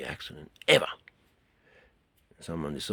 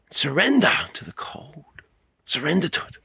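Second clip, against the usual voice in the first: urgent coaching; 9 semitones higher, 6 dB louder than usual. Shown as a demonstration. Coaching surrender to cold water.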